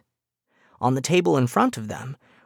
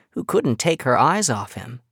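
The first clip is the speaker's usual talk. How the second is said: usual speaking voice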